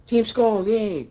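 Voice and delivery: mock cheer. Mock cheer at game event.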